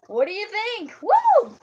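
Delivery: high, loud and thrilled; excited. Real, not acted. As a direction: squeal with excitement. Showing off new shirt.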